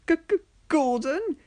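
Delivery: high nervous stammer. Acted. Thomas dreading Gordon's help, high nervous stammer. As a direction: stumble over the name. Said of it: "Thomas stumbled out with a reply".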